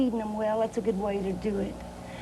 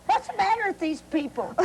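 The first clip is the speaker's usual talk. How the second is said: mock holler; 7 semitones higher than usual. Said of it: "she hollered".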